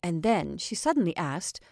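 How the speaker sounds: ordinary delivery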